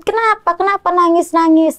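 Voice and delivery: high, anxious retold question; retold fear. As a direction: ask it high and worried, remembering the fear. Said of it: "Saya nambah takut". (nada tinggi cemas mengulang rasa takut)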